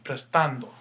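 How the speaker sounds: "Prestando" with "tan" accented; "Prestando", middle syllable stressed. Shown as a demonstration. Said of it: "where your accent should be".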